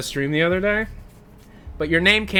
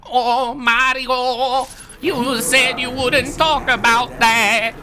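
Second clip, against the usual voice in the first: high, slow, drawn-out wail of a cartoon brother; Luigi; 6 semitones higher, slower than usual. Embarrassed brother scolds the plumber, high slow whine. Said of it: "Even with Luigi".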